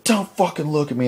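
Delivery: high, loud mock-furious yell; acted villain rage. Frank lashing out at the woman, mock enraged shout. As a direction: explode like an unhinged villain. Acts villain raging at her.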